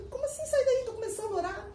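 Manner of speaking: startled back-talk to a voice in her heart; surprised protest. Retold or imitated. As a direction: protest in disbelief, pitch jumping up. Startled reply to inner voice.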